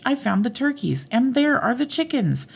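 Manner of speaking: high, thrilled call; excited calling. A narrator's reading. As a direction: announce the find from the treetop. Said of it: "shouted the ostrich".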